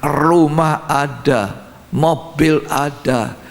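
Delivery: very slow, stretched-out list; drawn-out listing. Retold or imitated. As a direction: savour each item slowly, one after another. Drawn-out list of fleshly comforts. (daftar yang diucapkan lambat dan dipanjangkan)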